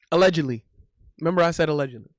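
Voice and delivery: ordinary talk, nothing marked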